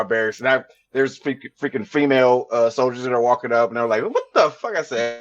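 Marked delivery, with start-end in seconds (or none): 4.0-4.8 higher, startled what-the; imitating shocked onlookers; retold shocked exclamation, pitched up; shocked question. Female soldiers see him naked.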